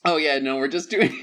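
ordinary talk

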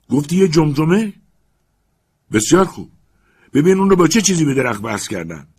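"گفتی یه جمجمه" asked with rising tune, loud; eager repetition. Acted. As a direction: snap back the question, eager and loud. Legrand repeats eagerly.

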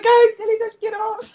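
high, loud re-enacted alarm; shouted mock warning. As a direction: shout as if clearing swimmers out of the sea. Re-enacts warning swimmers about jellyfish.

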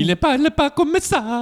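laughing high. Real, not acted. Joking with friends about Mario, laughing high.

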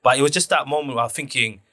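ordinary talk

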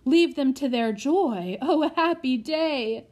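put-on old butler overjoyed at the reunion; joyful old butler. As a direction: beam through happy tears, voice lifting. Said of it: "grinning and wiping away tears".

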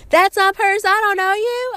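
high, loud outraged comeback; high-pitched, incredulous refusal; indignant protest. Retold or imitated. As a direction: squeal it in mock disbelief at a stranger. Acted outraged reply to a fan.

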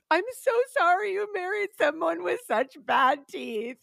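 high, tearful apology; retold crying. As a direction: replay the sob to your husband. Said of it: "I cried to my husband".

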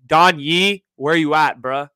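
loud mock call to an absent agent. Host calling out the agent who never called back, loudly. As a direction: shout it like he's across the room.

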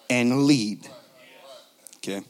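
ordinary delivery

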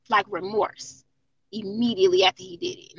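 ordinary talk, nothing marked

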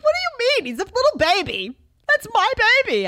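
high, loud, dramatized outcry; retold motherly protest. Mom objects to husband's sleep training, exaggerated indignant protest. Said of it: "I'm like".

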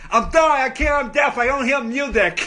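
loud, high, slurred imitation of deaf speech; mock deaf voice. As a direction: put on a crude fake deaf voice. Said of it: "I did a deaf voice".

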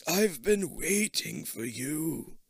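put-on slow, faint old man voice; drawn out and slow like an ancient man. Old man greets from inside.

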